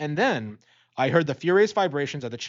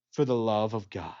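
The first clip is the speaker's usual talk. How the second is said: cold mocking echo; 5 semitones lower than usual. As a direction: throw his prayer back at him. Killer mockingly echoes the plea.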